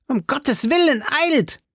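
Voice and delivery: very high frantic call; high-pitched desperate appeal; urgent cry. A narrator's reading. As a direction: cry for help in panic, pitched far up. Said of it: "rief Porthos".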